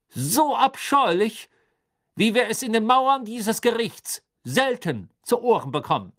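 high, loud, overpowering declaration. A narrator's reading. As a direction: raise your voice to drown someone out. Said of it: "sagte Crouch mit erhobener Stimme".

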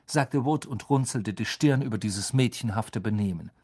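plain reading voice

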